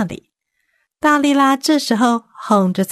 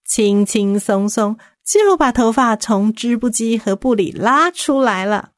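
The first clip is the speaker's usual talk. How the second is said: plain reading voice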